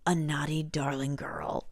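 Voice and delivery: slow, unvoiced, teasing whisper. Vane teasing Winnie in the lagoon, slow and whispered. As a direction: tease her under your breath.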